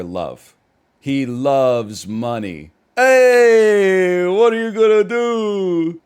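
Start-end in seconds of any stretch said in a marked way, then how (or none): 3.0-6.0 loud, high, drawn-out "heyyy"; playful holler, vowels held long; jokey exclamation. Acted. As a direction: stretch it out high and loud like a big joking shrug. Jokey shrug after teasing remark.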